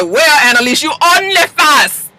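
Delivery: put-on playful high Belizean accent. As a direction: tease her in a Belizean accent. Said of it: "That's my Belizean accent".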